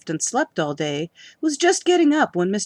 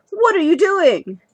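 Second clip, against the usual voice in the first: much higher, louder outraged demand; crying out; 11 semitones higher, 9 dB louder than usual. Acted. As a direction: confront the thief mid-act, shouting. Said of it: "she cried".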